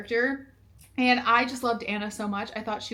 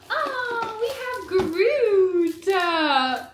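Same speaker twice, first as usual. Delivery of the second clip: higher, slow thrilled reveal; delighted discovery; 9 semitones higher, 4 dB louder, much slower than usual. Real, not acted. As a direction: savor the find with glee. Unboxing, excited discovery.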